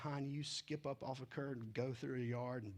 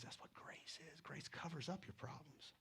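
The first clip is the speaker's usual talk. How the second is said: soft unvoiced whisper, close and secret; hushed whisper of the King; 12 dB quieter than usual. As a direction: lean in and whisper it like a confidence. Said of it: "And he whispers".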